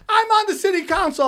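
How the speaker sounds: high-pitched mock woman's voice; mock self-important protest; indignant woman. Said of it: "the woman's like".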